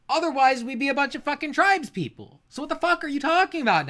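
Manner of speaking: high, heated, incredulous tirade; exasperated rant. Real, not acted. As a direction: let frustration push the pitch way up. Rants at opponent's argument.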